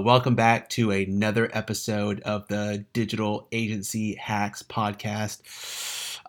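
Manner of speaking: ordinary talk, nothing marked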